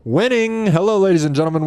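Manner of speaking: big, high, booming intro call; announcer voice. Acted. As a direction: hype it up like an arena announcer. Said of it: "this weird announcer voice came upon me".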